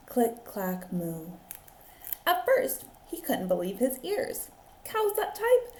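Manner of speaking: put-on lively read-aloud for children; animated picture-book reading; story narration. A narrator's reading. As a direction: tell it like a teacher at story time.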